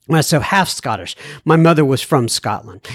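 ordinary talk

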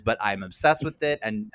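normal talking voice

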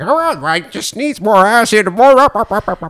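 high rising chant of up; judge's verdict. Retold or imitated. Judges telling a chef what the dish needs, rising excited chant. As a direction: push the flavour upward. Judging dishes.

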